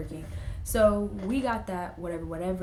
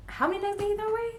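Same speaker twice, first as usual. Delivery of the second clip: high, fast, surprised dad; 12 semitones higher, much faster than usual. Retold or imitated. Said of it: "he's like".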